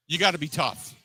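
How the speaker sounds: usual speaking voice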